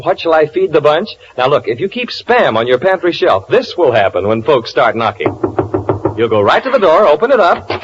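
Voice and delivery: ordinary talk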